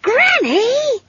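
put-on very high, scolding girl's cry; high-pitched exasperated call; exasperated child. A narrator's reading. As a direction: berate a wayward elder in a child's voice. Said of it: "in the exasperated and remarkably adult voice".

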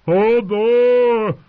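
high, drawn-out wailing moan; loud moan. A narrator's reading. Hodor beside himself in the storm, moaning loudly. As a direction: wail the name out long. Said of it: "moaned Hodor".

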